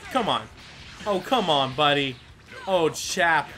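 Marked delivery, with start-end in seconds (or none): none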